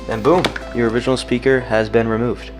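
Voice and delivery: ordinary talk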